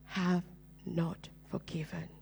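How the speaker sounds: a hushed, breathy voice from beyond, slow; Holy Spirit's whisper. Acted. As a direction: whisper it like a divine voice in the ear. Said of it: "And the Holy Spirit said".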